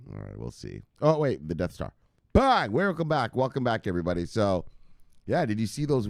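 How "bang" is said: punchy shouted bang. Real, not acted. Host shouting his catchphrase to the viewers. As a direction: hit it like a sound effect.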